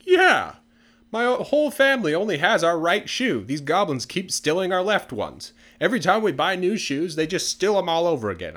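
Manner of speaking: high, loud, exasperated complaint; hammed-up grievance, pitched well up; indignant villager. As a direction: complain loudly like a fed-up townsman. Acted villager complaint to the crowd.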